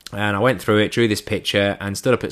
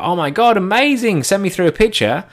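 excited, higher-pitched imitation of mates; excited friends' reaction; 11 semitones higher, 4 dB louder than usual. Friends hear about the new dog, excited. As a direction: act out delighted friends, pitched up.

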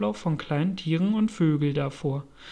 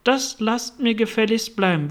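raised, higher-pitched command; mild acted shout, pitched up; shouted order; 4 semitones higher, 4 dB louder than usual. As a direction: call out a firm reprimand. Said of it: "schrie hinaus".

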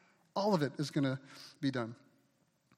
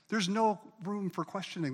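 ordinary talk, nothing marked

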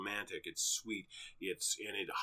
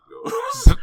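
much louder, near-yelling the repeated line; exasperated shout; 19 dB louder than usual. Real, not acted. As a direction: blow up on the third repeat for the joke. Repeated build-up, punchline take.

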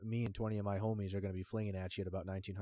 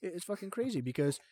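excited rant; 8 semitones higher, 4 dB louder than usual. Real, not acted. Excited rant about mob family.

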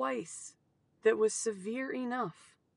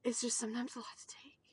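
overwhelmed whisper; 8 dB quieter, faster than usual. Real, not acted.

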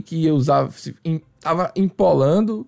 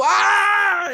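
long, high wail of pain; imitated pain scream; 13 semitones higher than usual. Client howling as wax comes off, imitated scream. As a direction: hold a high, hammed-up scream.

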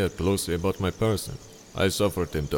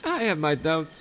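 higher, sweetly scheming host voice; honeyed charm pitched up high; Montresor, falsely friendly; 10 semitones higher than usual. Acted. Montresor dialogue.

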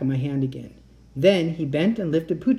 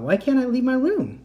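alarmed; 11 semitones higher, 3 dB louder than usual. A narrator's reading. Kat alarmed.

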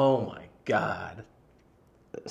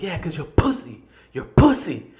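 high, loud jeer; retold insult, building louder on the repeat; angry taunt; 7 semitones higher, 8 dB louder than usual. Customer answering manager's police threat, angry jeer. Acted taunt during shouting match.

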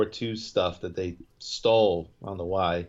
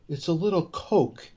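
higher, lightly voiced mock Richie; mimicking Richie; 7 semitones higher than usual. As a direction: toss off "little" like it's nothing, pitched up. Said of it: "The way he says it to him".

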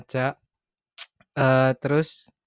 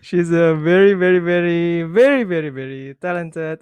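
excited emphasis; 3 semitones higher, 6 dB louder than usual. Acted. Hyping up the guest.

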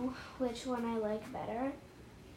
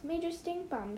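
understated storybook shout; mock shout; 6 semitones higher, 4 dB quieter than usual. A narrator's reading. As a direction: pretend shout, pitched up. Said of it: "shouted a girl".

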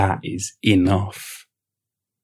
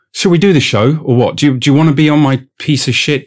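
sarcastic angry rant; 4 dB louder than usual. Acted. Podcaster ranting at Vlad, sarcastic and louder. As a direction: spit out the insults with mock grandeur.

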